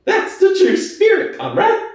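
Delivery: high, quick, eager pig voice; Squealer crying out. Acted. Squealer answering Boxer, high and eager. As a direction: cry it out high and sly. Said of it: "cried Squealer".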